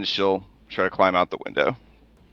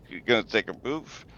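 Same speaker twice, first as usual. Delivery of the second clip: ordinary delivery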